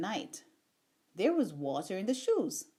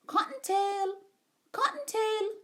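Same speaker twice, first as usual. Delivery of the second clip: high, carrying call from inside the burrow; 11 semitones higher than usual. A narrator's reading. Old Mrs Rabbit calling her child home, high calling out. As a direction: sing out a name to a child far off.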